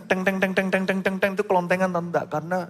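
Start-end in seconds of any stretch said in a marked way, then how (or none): 0.0-1.3 rapid high "teng" clanks; imitated knocking clangs. Father demonstrates knocking on car doors, imitated clanging. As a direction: mimic banging on a car door. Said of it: "saya selalu pukul-pukul pintunya".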